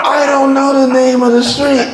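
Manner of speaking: hammed-up child's voice, very high; little boy. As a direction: imitate a small boy with amazing news. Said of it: "one little boy said".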